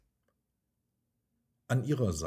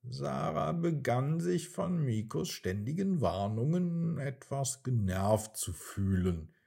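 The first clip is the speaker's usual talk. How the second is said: ordinary delivery, nothing marked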